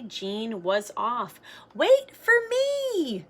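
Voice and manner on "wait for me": put-on high, slow, loud call after the kids. Girl to big kids riding away, high slow call. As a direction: call after them, stretching it out. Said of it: "she called".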